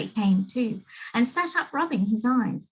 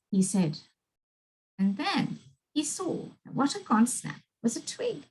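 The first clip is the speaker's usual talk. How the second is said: usual speaking voice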